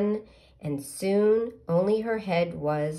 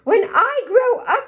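caterpillar voice; 11 dB louder than usual. Acted. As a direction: draw it out high and proud. Caterpillar tells pollywog again.

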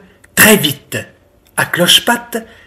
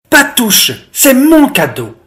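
higher-pitched indignant little goat; guard your toy with a childish protest; possessive protest; 7 semitones higher than usual. A narrator's reading. Biquette keeps her friend off her present, indignant.

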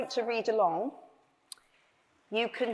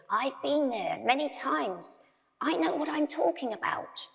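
higher-pitched aged croak; old croaky voice; 4 semitones higher than usual. A narrator's reading. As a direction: insist in a creaky old voice. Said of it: "which was old and croaky".

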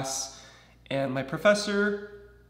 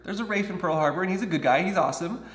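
ordinary talk

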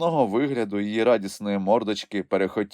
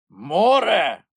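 high, louder gruff creature shout; Grampus shouting; 11 semitones higher, 5 dB louder than usual. A narrator's reading. Grampus sees the sea, dismayed creature shout. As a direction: bellow it in dismay. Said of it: "вигукнув Грампус".

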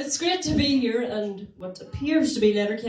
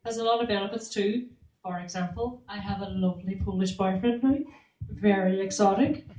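ordinary delivery

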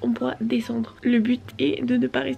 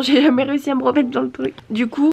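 ordinary delivery

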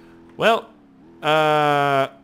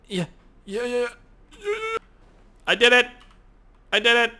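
thrilled high yeahs and cheer; excited; 9 semitones higher than usual. Real, not acted. Gamer cheering that the brake worked, excited. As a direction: celebrate a small win. Car finally works.

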